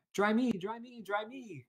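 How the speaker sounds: high, whiny chick plea; a soggy chick begging; crying baby chick. A narrator's reading. Wet chick begs Jack, high whine. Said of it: "cried the soggy, uh, baby chick".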